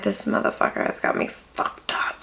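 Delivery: hushed, seething whisper; whispered aside about her man; whispered inner thought. Retold or imitated. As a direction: mutter it under your breath, furious. Inner fuming at club.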